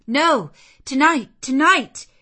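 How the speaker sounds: loud, high, demanding; urgent repeated demand; insistent outburst. A narrator's reading. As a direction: block his way with a forceful cry. Jane insists he stay.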